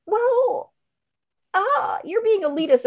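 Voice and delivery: put-on high, drawn-out snotty kid voice; a teen calls mom elitist, high and snotty; mocking kid voice. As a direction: play the sulky teen calling mom out. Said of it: "and they were like".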